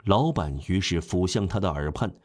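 usual speaking voice

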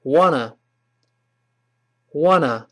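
"wanna" as one word with no t; fast reading. Shown as a demonstration. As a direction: merge "want to" into "wanna". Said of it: "读坏之后".

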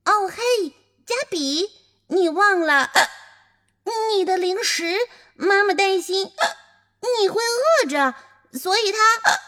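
a shy little sister stammering out an errand; little girl voice. Acted. Little sister brings a snack. (高而犹豫的小女孩声音)

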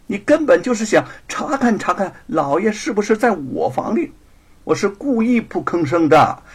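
teasing, high and loud comeback; coquettish mockery. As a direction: needle the rival with coy scorn. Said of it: "白雪燕儿娇笑起来". (娇声讥讽)

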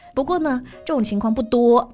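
usual speaking voice

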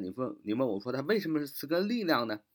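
ordinary talk

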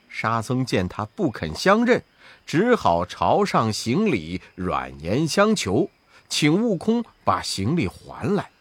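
ordinary delivery, nothing marked

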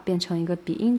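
ordinary delivery

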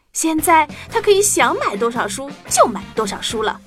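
ordinary delivery

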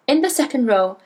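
plain reading voice

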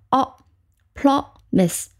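O as in ox, then promise in two slow pieces; short open O, then promise broken into pro and mise. Shown as a demonstration. Teacher showing the vowel of ox, short O, slow split promise. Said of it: "这个O发成短音".

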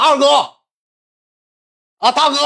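very high imitated call; mock called greeting. Little brother greeting gang in turn, mock shout. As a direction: hail each boss in a high voice. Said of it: "过去大喊一声".

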